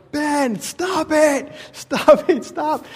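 high, frantic plea to stop; panicked pleading. Retold or imitated. Father begging his son on a runaway horse, high and frantic. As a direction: beg him to stop as if terrified. Retold panic on steep hills.

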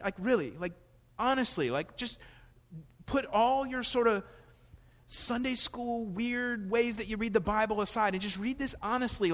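high, loud, driving sermon voice; fervent preaching. Acted. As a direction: press the promise home to the congregation. Preaching at full force.

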